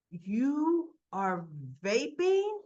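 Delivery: slow, drawn-out disbelieving question; imitating an appalled older brother; incredulous accusation. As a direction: stretch each word in disbelief. Brother confronts boy over vape.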